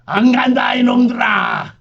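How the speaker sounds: high desperate crying shout; frantic screaming. Acted. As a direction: scream and thrash against capture. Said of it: "길길이 날뛰었다".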